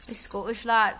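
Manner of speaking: put-on shaky try at a Scottish accent; badly faked Scottish accent; attempted Scottish accent. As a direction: have a go at sounding Scottish. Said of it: "I cannot do a Scottish accent".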